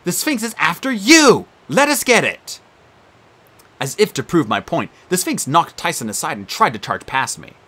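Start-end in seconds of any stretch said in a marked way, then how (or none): 0.0-2.6 shouting. Acted. Shouting orders in fight.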